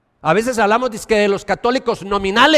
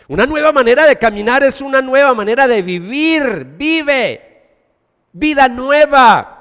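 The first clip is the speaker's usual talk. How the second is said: full-force preaching; 7 semitones higher, 6 dB louder than usual. Acted. Pastor proclaiming new life, loud and high. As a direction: preach it out to the congregation.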